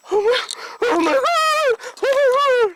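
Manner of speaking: high-pitched wail of terror; panicked cry. Acted. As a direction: cry out in sudden fright. Terrified by a ghost sighting. (घबराकर ऊँची आवाज़ में चीख)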